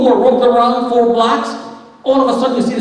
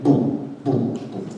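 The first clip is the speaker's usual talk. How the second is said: soft breathy booms, much lower; imitated booms; 9 semitones lower, 6 dB quieter, much slower than usual. Imitating the tower collapsing floor by floor, breathy booms. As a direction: mimic the floors crashing with quiet breathy booms.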